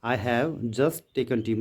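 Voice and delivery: slow, word by word, as if dictating; slow dictation. Shown as a demonstration. Teacher dictating the model sentence to students, slowly. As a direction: spell the sentence out slowly for the class. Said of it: "जैसे इस तरीके से हम करते हैं".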